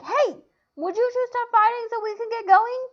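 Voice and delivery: loud, high shout to stop; yelling. Acted. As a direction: shout over the fight. Helen yelling at fighting men.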